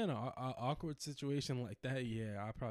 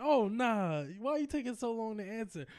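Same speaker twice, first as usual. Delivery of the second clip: higher, louder pushy nagging; pushy coworker voice; 10 semitones higher, 7 dB louder than usual. Retold or imitated. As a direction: press him like a guilt-tripping coworker. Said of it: "She was like".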